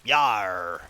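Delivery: low growled pirate yar. Acted. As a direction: grunt it like a pirate. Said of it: "Why do I sound like a cliche pirate?".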